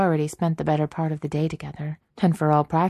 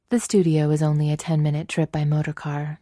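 plain reading voice

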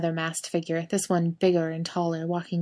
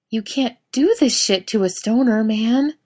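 stoner voice; 5 semitones higher, 4 dB louder than usual. A narrator's reading. Stoner pranked, stunned.

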